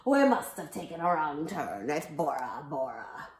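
low, loud pirate voice; hammed-up lost sea captain; storybook pirate. A narrator's reading. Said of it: "the pirate said".

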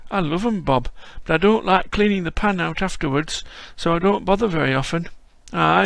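put-on old Yorkshire grandad greeting a visitor; frail old Yorkshireman. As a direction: play a creaky old Yorkshire lad-caller. Voicing old Yorkshire Bob.

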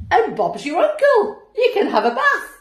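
chuckling through the words; cheerful laughing finish. Real, not acted. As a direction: let a laugh break through as you wrap up. Cheerful laugh ending bathtub tip.

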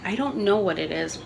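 ordinary talk

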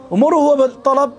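loud and high, hammering the point home; emphatic outburst. Real, not acted. Preacher to his congregation, a loud emphatic burst.

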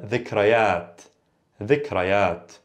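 "dhikrayat" starting with a voiced th; careful Fusha "dhikrayat", dh as in "the"; original th pronunciation. Shown as a demonstration. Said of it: "hear people say dhikrayat".

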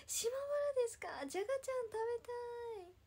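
high, loud and bright with sudden delight; excited. Real, not acted. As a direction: burst out with delight at the news. 長崎の視聴者に興奮する. (長崎出身と聞いて、はしゃいで高く大きな声で)

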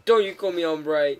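loud indignant retort; mock offence. Player answering a scientist's nickname, mock offended. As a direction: snap back loudly, playing offended.

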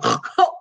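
short airy snorting laugh. Shown as a demonstration. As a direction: snort the laugh through your nose. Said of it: "The snort".

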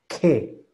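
"que" punched as a stressed syllable; "que" with emphatic high attack. Shown as a demonstration. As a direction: lift and hit "que" strongly. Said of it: "hacia arriba".

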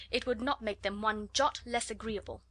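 usual speaking voice

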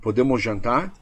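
tentative question with rising end; asking permission. Shown as a demonstration. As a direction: ask it as a request, pitch rising on "jantar". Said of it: "sugestão foi uma permissão".

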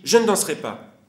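loud defiant refusal; cry out. A narrator's reading. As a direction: shout the refusal stiffly. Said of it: "raidit ses pieds et mains et crie".

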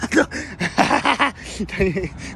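long bout of laughter. Real, not acted. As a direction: keep cracking up. Laughing with friend.

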